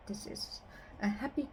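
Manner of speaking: ordinary delivery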